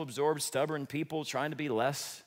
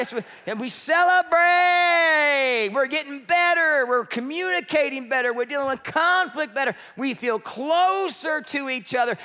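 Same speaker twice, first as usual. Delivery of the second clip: high, loud cheer of a happy couple; excited celebration; 9 semitones higher, 6 dB louder than usual. Acted. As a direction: shout the good news like a victory lap. Couple celebrating success together.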